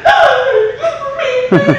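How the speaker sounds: put-on very high, loud squeal of a little boy; excited toddler. Dad voicing his toddler son, an excited squeal. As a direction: squeal like a thrilled two-year-old.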